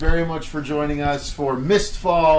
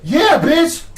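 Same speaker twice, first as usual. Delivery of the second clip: high, loud whoop rising into a roar; full-throated high battle roar; battle cry; 11 dB louder, much slower than usual. Acted. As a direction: yell it in triumph, then roar. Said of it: "and be like".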